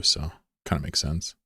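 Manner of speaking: ordinary talk, nothing marked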